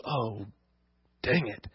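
the disciples groan under their breath at the task; muttered dismay. Retold or imitated. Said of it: "And they go".

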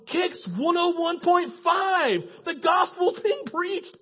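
high, loud, slow proclamation; marvelling, drawn-out shout; amazed exclamation. Retold or imitated. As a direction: stretch it out in rising amazement. Marvelling at gospel on radio.

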